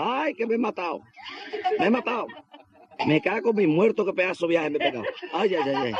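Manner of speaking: high-pitched comic wailing between laughs; hammed-up cries of a man who just fell; mock pained yelling. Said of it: "Y el gacho pegando gritos". (gritos exagerados de dolor, en broma)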